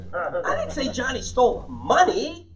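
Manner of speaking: "money" held back, then pushed up high; slow build to a rising, pointed "money"; stress on MONEY. Shown as a demonstration. Trainer hinting something else was stolen, stress on MONEY. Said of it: "he stole the car".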